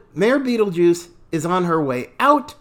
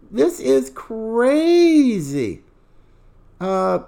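ordinary talk, nothing marked

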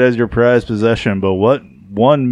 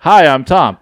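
higher, louder mock greeting; introducing himself; 5 semitones higher, 6 dB louder, slower than usual. Imagined amnesiac greeting a stranger, mock introduction. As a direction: chirp it up as a forgetful man's hello. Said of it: "He's gonna be like".

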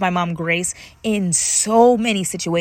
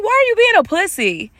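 high, loudish mock scolding; scolding mom; 12 semitones higher, 4 dB louder than usual. Daughter imitating mom mocking her crying, comic high scolding. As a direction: imitate an incredulous mom, pitched way up. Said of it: "She's like".

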